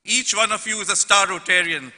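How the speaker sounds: normal talking voice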